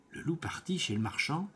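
plain reading voice

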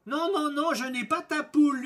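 high, loud frightened yell; acted panicky howl of refusal. A narrator's reading. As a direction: yell your denial at a high pitch. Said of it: "hurla l'aubergiste".

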